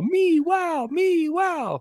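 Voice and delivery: high, slow, excited cartoon cat. Cats in the YouTube cartoon cheering, a high cartoon voice. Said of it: "the twins yell".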